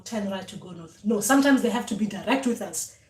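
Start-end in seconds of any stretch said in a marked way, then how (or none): 0.0-1.0 quiet echo. Shown as a demonstration. Dreamer repeating the ancestors' directions, softly. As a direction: murmur it back obediently. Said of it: "and you go".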